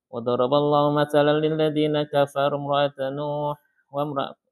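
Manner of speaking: quick, soft Quranic chanting; Quran recitation. Shown as a demonstration. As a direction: recite the verse in tajwid chant. Reciting Quran verse in class. (lantunan tilawah cepat dan pelan)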